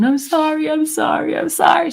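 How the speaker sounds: repeated sorry, pitched high and pleading; crying apology. Retold or imitated. Mother imitating daughter sobbing sorry on the phone, high and pleading. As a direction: beg forgiveness over and over, voice lifted. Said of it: "She kept crying".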